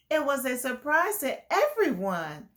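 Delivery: ordinary delivery, nothing marked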